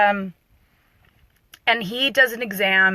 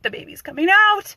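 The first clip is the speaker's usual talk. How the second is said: much higher, loud panicked wail; imitating rising panic; 12 semitones higher, 4 dB louder than usual. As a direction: push the panic to the top of the voice. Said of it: "she is panicking".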